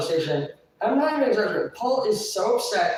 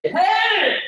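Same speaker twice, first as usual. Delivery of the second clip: high, loud imitation shout; mock full-volume yell; screaming; 8 dB louder than usual. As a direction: holler it right back. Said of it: "if you don't stop screaming".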